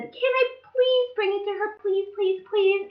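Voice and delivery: put-on very high, pleading child voice; high whiny please please please; begging little girl. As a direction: beg mommy like an eager kid.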